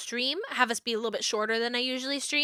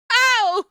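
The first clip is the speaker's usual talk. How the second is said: pained yelp; 11 semitones higher, 7 dB louder than usual. Real, not acted. Getting bullied by dog.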